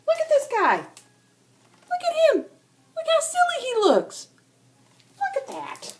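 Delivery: high, teasing voice pointing at him; mock the odd duckling in a high sing-song; mocking sibling.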